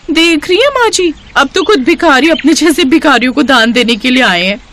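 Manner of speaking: high, sneering jibe; mocking taunt. Bride mocks her sister-in-law in front of mother-in-law, high and sneering. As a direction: sneer at the poor couple.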